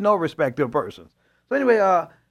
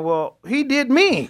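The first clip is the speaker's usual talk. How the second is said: much higher, louder, slow, retold objection; indignant protest; 10 semitones higher, 8 dB louder, slower than usual. Arguing back with the Lord, indignant slow protest. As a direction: protest like you were the one wronged.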